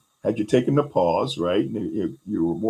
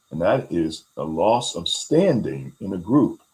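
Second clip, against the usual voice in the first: normal talking voice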